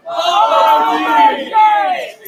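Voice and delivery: loud shouted reply; crowd shout. Real, not acted. Crowd answering the poem's cue, loud shout. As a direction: shout it back.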